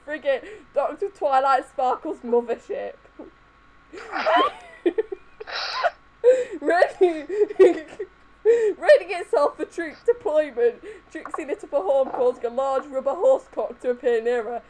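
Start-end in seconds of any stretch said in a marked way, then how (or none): 3.9-4.6 high short laugh; real giggle. Real, not acted. Laughing at the story. 6.6-10.8 higher, words squeezed through laughter; real laughter mid-sentence; laughing while reading. Real, not acted.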